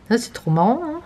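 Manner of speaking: usual speaking voice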